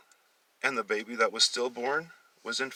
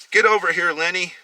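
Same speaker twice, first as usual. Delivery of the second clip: loud, high call across the house; 10 semitones higher, 11 dB louder than usual. Acted. Mother calling her son loudly across the house. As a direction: shout for her son to come. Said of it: "Tabitha was calling out".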